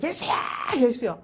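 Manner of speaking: high, strained call for help; crying out. Retold or imitated. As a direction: call out urgently to someone out of sight. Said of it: "소리를 질렀습니다".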